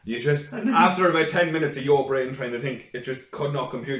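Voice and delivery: normal talking voice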